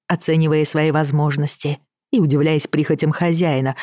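usual speaking voice

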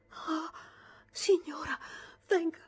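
high, loud panicked plea; frightened maid. Acted. Maid Line to the lady, breathless frightened plea. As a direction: beg the lady to come at once.

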